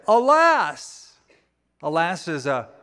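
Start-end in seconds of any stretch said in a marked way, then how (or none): 0.1-1.1 sudden high cry of dismay. Acted. Prophet crying out as the axe head sinks, dismayed. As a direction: cry out in despair over the lost axe head. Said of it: "he cried out and said".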